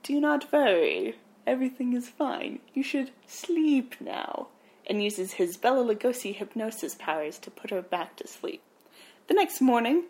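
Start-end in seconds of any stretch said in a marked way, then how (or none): 0.0-4.5 soothing hypnotist. Retold or imitated. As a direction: croon "sleep now" like a mesmerist. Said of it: "Voronov is like".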